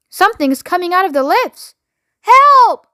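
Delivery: rising panic ending in a high loud yell for help; terrified as the thing comes out; panicked cry for help. A narrator's reading. Manager sees monster coming.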